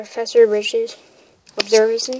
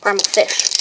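normal talking voice

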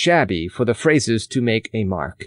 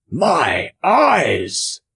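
surprised cry; 6 semitones higher than usual. A narrator's reading. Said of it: "cried the farmer".

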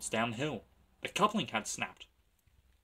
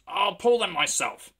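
proud engine; 8 semitones higher, 7 dB louder than usual. Acted. James proud.

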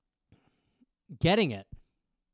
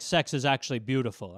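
a hint of low, earnest father; lightly voiced dad, lower; gentle dad; 7 semitones lower than usual. Dad explaining to his son, low.

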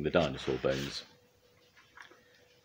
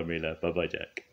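ordinary delivery, nothing marked

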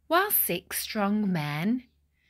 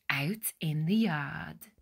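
usual speaking voice

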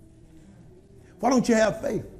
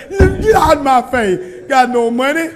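mock irritated brush-off; 2 semitones higher, 8 dB louder than usual. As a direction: shoo the beggar away. Acting out rebuffing a beggar.

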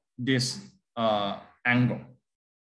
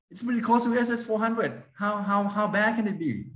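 higher, pleading student excuse; imitating an arguing student; 7 semitones higher than usual. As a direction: play the student brushing it off. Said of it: "you argue that".

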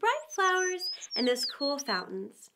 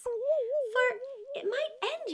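much higher, very slow worried voice; nervous Alice; 11 semitones higher, much slower than usual. A narrator's reading. Alice worrying to herself she might vanish, slow and nervous. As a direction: draw it out anxiously. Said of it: "said Alice to herself".

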